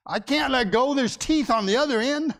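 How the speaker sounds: the bear yelps back that he can't let go; cartoon bear protesting.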